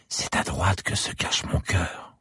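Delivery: put-on slow, breathy child's whisper; in a breath. A narrator's reading. Little girl confides her secret to the policeman in a slow whisper. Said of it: "confia-t-elle dans un souffle".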